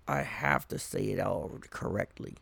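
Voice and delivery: mock try-hard. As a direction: imitate the earnest corrector quietly. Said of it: "cultural people who are like".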